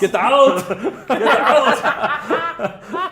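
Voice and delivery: laughing barked orders, high; drill sergeant shout. Acted. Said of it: "drill sergeant here".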